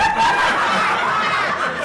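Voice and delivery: loud burst of laughter; high laughing burst. Real, not acted. Big laugh at own joke.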